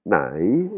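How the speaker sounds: "nai" dipping down then swinging up; broken tone. Shown as a demonstration. Teacher isolates nai for students, broken dipping tone on "nai". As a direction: break "nai" in the middle, down then up. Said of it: "il est cassé".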